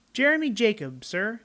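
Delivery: put-on high little boy answering the captain; boy's voice. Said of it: "I told him".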